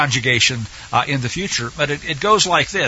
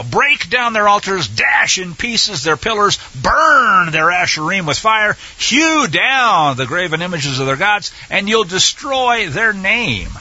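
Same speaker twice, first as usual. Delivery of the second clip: slow, forceful stress on "Break", "dash", "burn", "hew"; very emphatic; 3 semitones higher than usual. Acted. Teacher reading out commands to destroy idols, very emphatic. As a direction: punch and stretch each verb, "burn" hardest. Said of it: "it basically is very emphatic".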